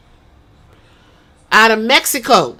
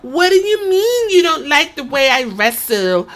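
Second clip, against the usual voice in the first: put-on valley girl voice; 7 semitones higher, 3 dB louder than usual. Shown as a demonstration. Mocking a Paris Hilton-like wrestler, high and whiny. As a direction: whine it like a spoiled socialite. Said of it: "talk like this".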